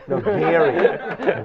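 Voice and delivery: laughing. Real, not acted.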